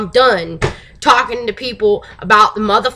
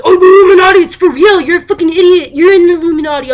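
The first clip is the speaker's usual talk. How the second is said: high, loud mocking rant; mock angry commenter; 8 semitones higher, 8 dB louder, faster than usual. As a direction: imitate an indignant online commenter, loudly. Acts out expected hostile comments.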